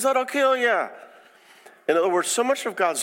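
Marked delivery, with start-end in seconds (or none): 0.0-0.9 urgent warning. Retold or imitated. God warning of consequences.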